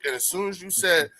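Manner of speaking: normal talking voice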